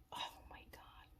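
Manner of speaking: breathless whispered awe; awed whisper. Real, not acted. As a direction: whisper it as if the food stuns you. Tasting food, awed by meatball.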